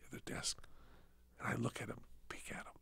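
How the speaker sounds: hushed, secretive retelling; hushed telling. Retold or imitated. Telling a friend about sneaking past, whispered. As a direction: drop to a near whisper as if sneaking. Sneaking a look at the desk guy.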